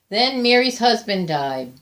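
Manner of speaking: usual speaking voice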